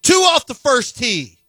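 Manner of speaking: high, drawn-out call; slow, high-pitched repeat for emphasis; emphatic call. Pretend.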